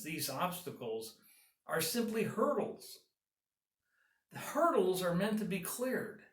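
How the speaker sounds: ordinary talk, nothing marked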